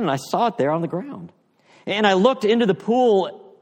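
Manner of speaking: Eustace marvels as his scales fall off; excited retelling. Retold or imitated. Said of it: "Eustace says".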